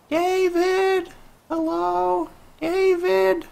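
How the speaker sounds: very high, distant falsetto call; mom calling from afar. Acted. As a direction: holler through the house in a shrill-pitched mom voice. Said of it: "that's what her mom sounds like in the distance".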